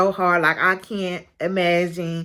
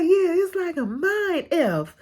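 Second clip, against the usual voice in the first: quoting it again, swapping the curse for F; a light mock of her, cursing bleeped; censored requote; 11 semitones higher than usual. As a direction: repeat the line, cleaned up with a wry laugh. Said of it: "And she was just like".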